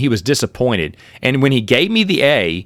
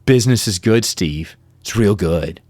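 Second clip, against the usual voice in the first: smug reply; 4 semitones lower than usual. Retold or imitated. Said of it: "And I went".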